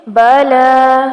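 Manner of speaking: slow بلا with the final aa drawn out; بلا recited with a long drawn aa; stretched vowel. Shown as a demonstration. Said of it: "اس کو بھی کھینچ کے پڑھیں گے".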